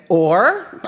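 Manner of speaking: a mock-innocent "Or?" through a laugh; infuriating prompt. Imitating the therapist's teasing prod, laughing through it. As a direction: tease the question out, half laughing. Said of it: "and then infuriatingly say".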